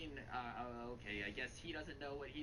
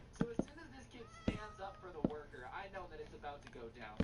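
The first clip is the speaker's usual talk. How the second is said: usual speaking voice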